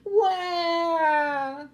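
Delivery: high, drawn-out bawl; hammed-up child's boo-hoo; mock crying wail. A narrator's reading. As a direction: burst into a loud storybook wail. Child bursts into tears.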